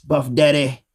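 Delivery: normal talking voice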